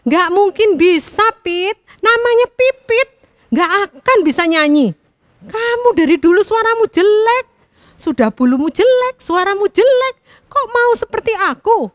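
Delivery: high, loud mocking insults; proud taunting. A narrator's reading. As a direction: rub it in with contempt. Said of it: "si burung kutilang membanggakan dirinya".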